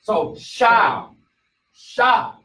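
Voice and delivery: sha with an open ah vowel; sha demo. Shown as a demonstration. Teacher showing students shin plus ah, sha with open ah. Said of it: "but I put the ah sound".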